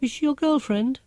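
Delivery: high, breezy, too-casual question; oh so casually. A narrator's reading. Mum asks her son about Marcia, teasingly casual. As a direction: slip it in as if it hardly matters. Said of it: "oh so casually".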